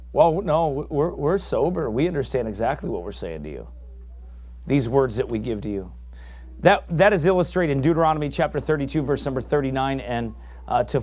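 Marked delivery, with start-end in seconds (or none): 0.1-5.9 calm sober reply. Retold or imitated. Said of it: "answering the accusers".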